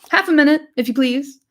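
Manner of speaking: tossed off in a hurry, loud; busy landlord's shout. Acted. Butterbur calling back to Frodo over his shoulder, loud. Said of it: "shouted the man over his shoulder".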